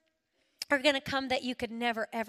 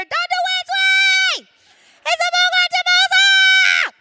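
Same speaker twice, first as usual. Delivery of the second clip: put-on high, very loud child's scream, slow and drawn out; screaming scared toddler; 15 dB louder than usual. Little Ross screaming to the doctor, terrified child shriek. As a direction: wail it like a terrified little boy. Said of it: "Ross is screaming in my ear".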